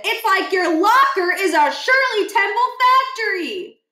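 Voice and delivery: put-on a light high dopey boy; lightly voiced dopey kid; goofy Stuart. Stuart to Miles about the locker, high and goofy. Reread of the same line.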